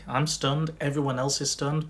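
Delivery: ordinary delivery, nothing marked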